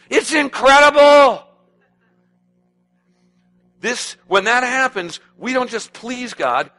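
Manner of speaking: high, loud, slowing preacher's climax; full-force preaching. Real, not acted. Preacher exhorting congregation about new nature, fervent. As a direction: preach it out, rising passion.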